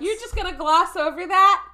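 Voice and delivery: loud, high indignant protest; incredulous outburst at being ignored; mock outrage. Real, not acted. Calls out cohost for missing joke.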